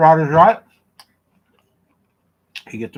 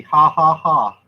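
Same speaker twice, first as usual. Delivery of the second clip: mocking laugh; 3 semitones higher, 9 dB louder, much slower than usual. Real, not acted. Teasing Cassie with sweet tea.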